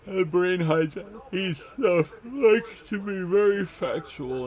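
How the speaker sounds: slow, high and loud; put-on slow, high-pitched emphasis; exaggerated mocking voice. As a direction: drag it out high and loud, hammed-up.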